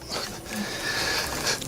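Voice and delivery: quiet breathy stifled laugh; stifled giggle. Real, not acted. Woman stifling a giggle while the officer scolds, breathy giggle. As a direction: try to swallow a giggle. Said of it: "[name]'s giggles".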